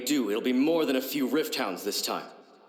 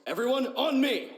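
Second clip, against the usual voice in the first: rallying call; 9 semitones higher than usual. Acted. Calling troops to rally.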